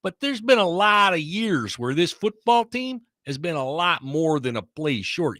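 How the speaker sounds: put-on Chicago accent. Recapping the Bears-Packers game for the host in a thick Chicago accent. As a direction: play a Chicago sports guy recapping the game. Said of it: "that was a very well done accent there".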